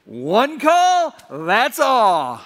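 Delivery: loud slogan called out, first half pitched high; mimicked crowd catchphrase, loud and drawn out; imitated heckling call. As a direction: call it out like young fans heckling. Young men yelling slogan at lawyer.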